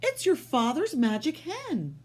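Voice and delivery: light up with wonder; mother exclaiming. Acted. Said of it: "she exclaimed".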